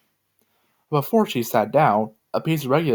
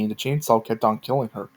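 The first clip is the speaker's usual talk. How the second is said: usual speaking voice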